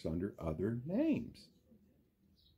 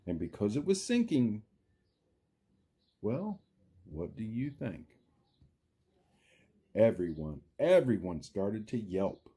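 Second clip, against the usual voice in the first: lively storybook read, big rises and falls; reading to children. A narrator's reading. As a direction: read it like story time for little kids. Teacher reads Seuss book aloud.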